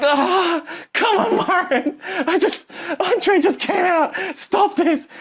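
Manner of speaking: squeaky high whine, laughing through it; hammed-up high-pitched protest at the dinner table; mock whiny protest. To Myron at dinner, a squeaky laughing whine. Teller voicing his own protest.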